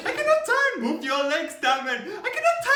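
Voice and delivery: very high, loud panicked shriek. Retold or imitated. Girl on the team shrieking as she heads for a tree. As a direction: shriek for help like she can't stop. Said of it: "She's like".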